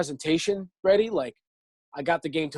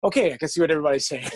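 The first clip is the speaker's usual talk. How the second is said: fast, resigned; 4 dB louder, much faster than usual. Retold or imitated. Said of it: "And I was like".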